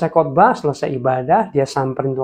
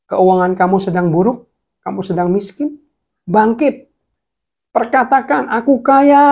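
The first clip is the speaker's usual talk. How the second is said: louder, higher preaching, peaking on "Aku kaya"; fiery preaching; 5 semitones higher, 4 dB louder than usual. Acted. Preacher urging the poor to speak riches, rising loud exhortation. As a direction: whip the crowd into declaring it.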